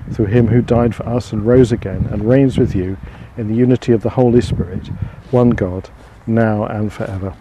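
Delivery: earnest, ringing prayer aloud. Real, not acted. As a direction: pray it out to the open air.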